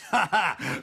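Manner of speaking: a short mocking laugh; scornful laugh. Old neighbour laughing at the threat of court, scornful.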